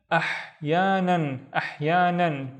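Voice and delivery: "ahyanan" drawn out slowly with a throaty h; slow, careful "ahyanan"; slow blend. Shown as a demonstration. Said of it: "slowed word demo".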